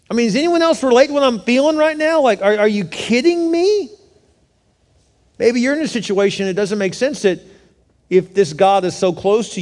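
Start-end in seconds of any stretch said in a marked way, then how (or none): 0.2-3.9 high, incredulous appeal; exasperated. Acted. Preacher asking if anyone relates to his feelings, exasperated. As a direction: plead for someone to share your disbelief.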